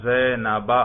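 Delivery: زینب closing on an open short "-ba"; accusative ending -a. Shown as a demonstration. Teacher confirms the accusative for students, زینب ending in -a. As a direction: say زینب as "Zainaba". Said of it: "حالت نصبی میں کیسے پڑھیں گے".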